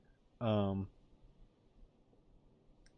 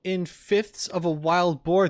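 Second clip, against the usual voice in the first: slow, high, rising disbelief; incredulous echo; 8 semitones higher, 3 dB louder, slower than usual. Real, not acted. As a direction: repeat it slowly as a baffled question. Echoes the game's silly measurement unit.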